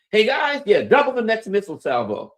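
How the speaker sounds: loud, high shouted order; calling out. Acted. Iranian commander orders the missile crew, calling out loudly. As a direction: holler the order across to your crew.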